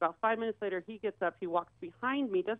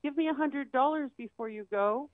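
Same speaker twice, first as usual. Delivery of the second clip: higher, sugary begging call; mock wheedling girlfriend; 7 semitones higher than usual. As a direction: play the greedy girlfriend asking for cash. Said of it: "she says behind us".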